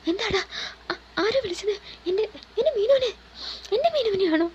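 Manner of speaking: high, frantic stammering questions; panicked. Acted. Atharv hears Meenu is missing, panicked and pleading. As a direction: voice jumps up in panic for his love. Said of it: "പരിഭ്രമത്തോടെ ചോദിച്ചു".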